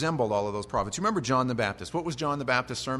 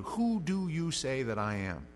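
slow weighty question; slower than usual. Acted. Repeats question slowly.

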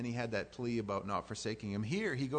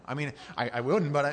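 ordinary talk, nothing marked

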